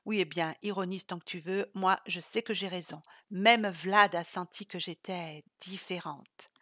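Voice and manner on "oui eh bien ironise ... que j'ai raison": ordinary delivery, nothing marked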